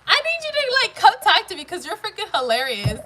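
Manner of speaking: high, loud, excited praise; delighted outburst. Real, not acted. Telling a hilarious viewer to come talk, delighted. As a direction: gush loudly, tickled by the joke.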